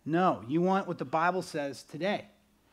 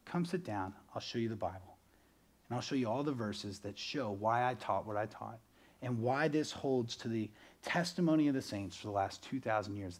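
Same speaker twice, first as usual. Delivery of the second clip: softer, lower, reassuring invitation; gentle pastor; 6 semitones lower, 7 dB quieter than usual. Retold or imitated. Good pastor inviting a congregant to sit and read, gentle. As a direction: calmly welcome a doubter in.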